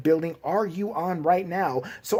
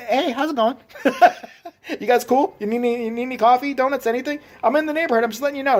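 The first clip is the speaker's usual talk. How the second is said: mock friendly caller; 6 semitones higher, faster than usual. Mock friendly call to cops.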